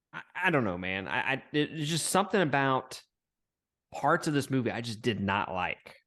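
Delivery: normal talking voice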